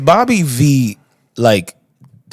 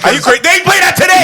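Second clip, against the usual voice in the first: excited shout; faster than usual. Real, not acted. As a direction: shout it in disbelief. Excited outburst.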